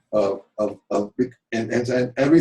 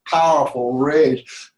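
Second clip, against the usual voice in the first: ordinary talk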